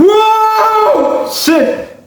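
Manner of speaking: high, sung-out whoa of alarm; startled whoa. Retold or imitated. Woken by his mother with a stick, a high startled yelp. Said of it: "I'm like".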